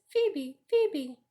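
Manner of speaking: high two-note bird cry; birdcall. A narrator's reading. The phoebe on the apple bough, high birdcall. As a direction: call it like a little bird.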